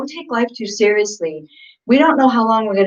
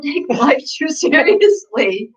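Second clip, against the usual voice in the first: laughing; 5 semitones higher, slower than usual. Real, not acted. Laughing at her own joke.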